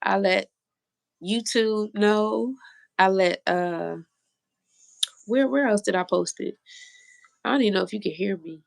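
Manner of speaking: ordinary delivery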